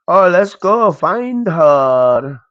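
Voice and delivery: ordinary talk, nothing marked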